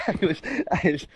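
high, giggling through words; laughing. Real, not acted. Friend laughs at teammate's surprise return. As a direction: laugh while trying to speak.

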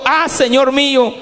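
preacher's acted outcry; retold cry. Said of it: "gritó diciendo".

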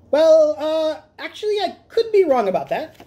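high squeaky, fast nervous admission; sheepish backtrack. Acted. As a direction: back down quickly, embarrassed. Cat escaping, sheepish backtrack.

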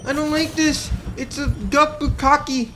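high-pitched alarmed outburst; panicked dismay. Real, not acted. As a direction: cry out in alarm at the onslaught. Swamped by attacks in game.

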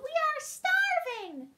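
high, loud, drawn-out wail; desperate little turtle crying out; groaning Mac. A narrator's reading. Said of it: "groaned Mac".

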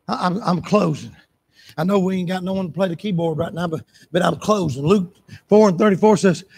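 ordinary delivery

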